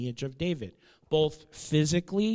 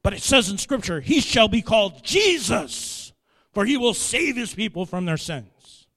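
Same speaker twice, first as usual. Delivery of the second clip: upset man quoting; 6 semitones higher, 9 dB louder than usual. Retold or imitated. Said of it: "he got very upset".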